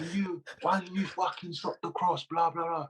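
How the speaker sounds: half-hearted Scottish manager ranting; attempted Scottish accent. Mocking the Scottish gaffer, a poor Scottish accent. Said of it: "I can't do a Scottish accent".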